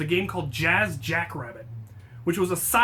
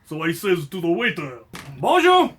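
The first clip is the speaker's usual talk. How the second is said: mock Russian accent; 6 dB louder than usual. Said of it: "Now we are Russian".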